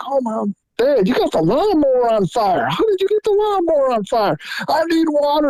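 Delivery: put-on alarmed kid. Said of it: "and I'm like".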